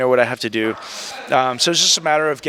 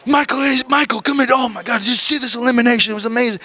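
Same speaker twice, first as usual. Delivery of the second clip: high, excited gushing shout, much higher than own voice; Pat Patterson; 9 semitones higher, faster than usual. Retold or imitated. Backstage boss raving to the office about the elimination, high excited shout. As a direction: gush like a thrilled old wrestling boss. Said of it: "He's like".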